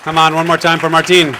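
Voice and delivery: loud host call to the crowd; rally the audience for another round. Real, not acted. Host asking the crowd to cheer Martine again, loud call.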